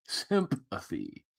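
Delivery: soft echo. Shown as a demonstration. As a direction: let "Sympathy" die away to a hush.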